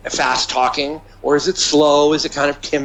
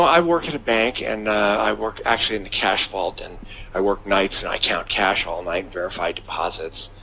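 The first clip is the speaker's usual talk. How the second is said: low, quiet, droning bank worker; dull bean counter; 7 semitones lower, 5 dB quieter than usual. Acted. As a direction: recite the job in a low, subdued voice.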